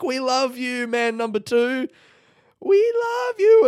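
high loud fawning cries; adoring groupies. Acted. Women at the graveyard fence call out adoringly to the second man. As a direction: swoon and call out to him.

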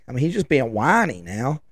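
ordinary delivery

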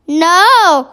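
loud, drawn-out no; crying out. A narrator's reading. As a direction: cry out in protest. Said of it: "cried Sophie".

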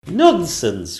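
loud, high indignant queen; indignant cry. Acted. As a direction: dismiss him at once. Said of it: "cried the Queen".

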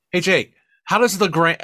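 ordinary talk

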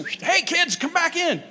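high, breezy call to the dogs. Pretend. Calling his dogs back into the house, high and breezy. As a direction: sing out to the pets like they'd obey.

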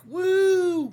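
loud high whoop; excited whoop. Real, not acted. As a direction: cheer it out loud. Podcast intro cheer.

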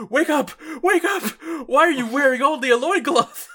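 high, loud frantic shouts; panicked yelling. Retold or imitated. Hisagi shouting at blacked-out Izuru, high frantic yell. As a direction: yell to wake a passed-out friend.

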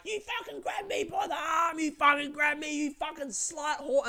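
very high screamed impression; imitated shrieking. Screaming woman to the dining car lady, imitated high shrieking. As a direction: screech it high, mimicking an irate woman. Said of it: "then she starts going".

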